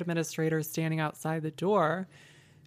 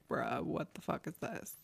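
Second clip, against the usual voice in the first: muted disbelief; 9 dB quieter than usual. Retold or imitated. Walking into the staffing agency, in quiet disbelief. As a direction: say it under your voice. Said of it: "it's like".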